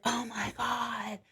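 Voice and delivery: slow, soft mock awe; imitated hushed awe. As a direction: draw it out softly, as if awestruck. Imitates awestruck type of person.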